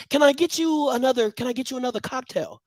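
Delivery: mock polite server. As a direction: play a fawning pledge serving drinks. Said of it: "You go up to the Deltas".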